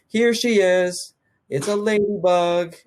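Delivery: put-on high, slow, loud baby-talk coo; cooing dog lady. Neighbor shows off her ladybug dog in cooing baby talk. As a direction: coo it like showing off a pet. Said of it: "She goes".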